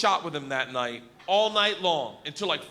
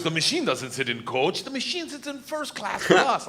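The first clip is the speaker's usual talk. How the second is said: put-on Russian accent; 3 semitones higher than usual. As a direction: insist proudly in a Russian accent, climbing in pitch. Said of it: "He grabs me and he goes".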